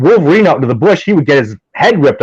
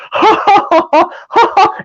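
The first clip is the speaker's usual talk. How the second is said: high, bursting mock laugh, much higher than his voice; imitated laughter. Jeff laughing at a joke by the campfire, mock high laugh. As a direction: imitate a friend's giggling laugh, pitched way up. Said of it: "So he's going".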